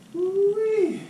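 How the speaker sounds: high, loud excited holler; happy whoop. Real, not acted. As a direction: let out a joyful whoop. Happy whoop after greeting partner.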